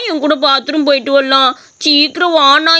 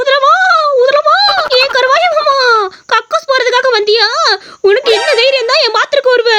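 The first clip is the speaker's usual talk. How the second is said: haunt them in a high ghostly voice; the toilet ghost scaring the boy in a high shrill voice; 7 semitones higher than usual. Acted. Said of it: "நீயே இப்படி வேற குரல்ல பேசிட்டு பயமாத்திக்கிட்டு".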